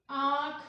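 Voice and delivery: loud "आँख" with a long open nasalised vowel; chandrabindu pronunciation. Shown as a demonstration. Teacher demonstrates chandrabindu to students, open "आँख". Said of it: "यहाँ पे आप उच्चारण कर रहे हो ना".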